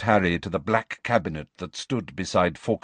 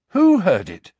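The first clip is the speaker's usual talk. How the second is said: Harry's voice; 9 semitones higher, 8 dB louder than usual. A narrator's reading. Harry asks urgently.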